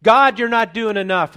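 loud, high outcry at God; indignant complaint. Acted. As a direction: call out in protest, pitched high. Voices the prophet's complaint to God.

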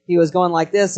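ordinary talk

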